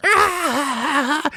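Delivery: high, startled mock "Ah!"; mock shocked gasp. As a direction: gasp like she's caught off guard. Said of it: "and she's like".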